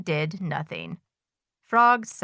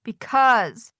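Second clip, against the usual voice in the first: raised, plaintive whine; a touch of wailing, higher and louder; mild wail; 4 semitones higher, 5 dB louder than usual. A narrator's reading. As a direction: begin a mock wail. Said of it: "wailed Toad".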